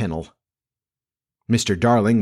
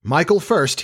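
higher, firmly insistent; dogged; 7 semitones higher than usual. A narrator's reading. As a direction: dig your heels in. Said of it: "he said doggedly".

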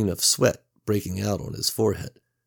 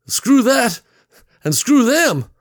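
high, loud furious yell; angry shout; 9 dB louder than usual. A narrator's reading. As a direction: bellow it while running away. Mac yells while fleeing, huffing.

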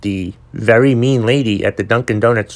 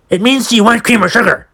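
high, louder, impatient snap; mock-impatient drive-thru worker snapping at a customer; snappy cashier; 12 semitones higher, 4 dB louder than usual. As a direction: bite off the answer like a fed-up cashier. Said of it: "she, like, snapped at me".

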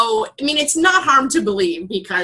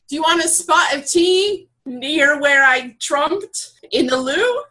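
attempted British accent on demand; 3 semitones higher than usual. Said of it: "I need an accent here".

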